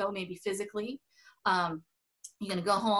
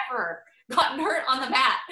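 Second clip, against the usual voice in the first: laughing; 3 semitones higher, 4 dB louder than usual. Real, not acted. Laughing while insisting.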